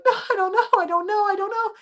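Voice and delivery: high, frantic repeating of I don't know; scared and confused after the fall; panicked. Retold or imitated. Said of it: "And so I'm panicking".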